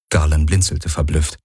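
plain reading voice

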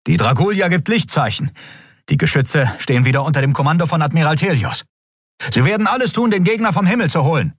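high, crisp officer's report. A narrator's reading. Officer reporting to the captain that the enemy retreats.

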